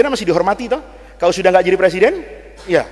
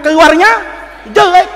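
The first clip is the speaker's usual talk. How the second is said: "keluarnya jelek" shouted out loud and high; punchline yelled from the pulpit; 11 semitones higher, 11 dB louder than usual. Shown as a demonstration. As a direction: throw "keluarnya jelek" at the crowd. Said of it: "repeats the phrase, then shouts it".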